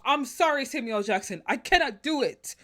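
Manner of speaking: indignant, comically worked-up complaint; retold pissed-off rant. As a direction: rant as if telling off the cast, hammering each refusal. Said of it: "how pissed I was".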